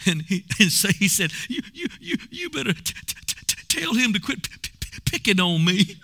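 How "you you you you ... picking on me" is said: hammed-up whiny sobbing stutter; whining and crying. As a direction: stammer it out like a tearful tattletale. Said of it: "He was all whining and a crying".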